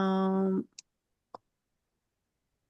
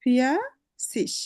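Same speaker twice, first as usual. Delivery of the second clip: slow model word; 3 semitones higher, much slower than usual. Shown as a demonstration. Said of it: "teacher modelling the word slowly".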